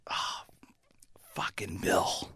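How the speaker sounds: hushed, whispery exasperation; exasperated groan. Retold or imitated. Manager hearing about Bill upstairs, hushed exasperation. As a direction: let it out softly, head in hands. Manager despairs, head down on table.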